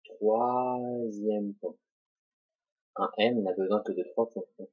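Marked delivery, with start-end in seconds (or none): 0.0-1.8 long held syllables, very slow; drawn out. Shown as a demonstration. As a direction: stretch it slowly along with the pen stroke. Narrating the stroke while drawing it. (très lent, syllabes étirées)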